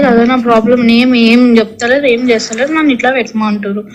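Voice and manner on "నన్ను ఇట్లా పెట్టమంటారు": ordinary delivery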